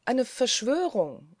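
drawn-out indignant cry; hissed outcry. A narrator's reading. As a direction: spit it out as an accusation. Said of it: "die Worte beinahe zwischen den Zähnen hervorzischend".

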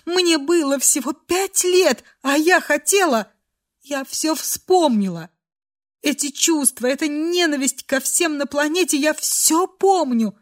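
tearful, high-pitched outpouring, mildly overwrought; anguished confession. A narrator's reading. Girl recalls hating everyone at five, anguished. Sobbing girl's confession continues.

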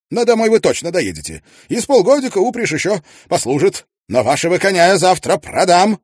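Tietjens voiced. Tietjens advising Mrs Wannop on her cart, brisk and confident. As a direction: rattle off the practical advice with assurance.

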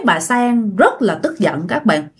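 ordinary delivery, nothing marked

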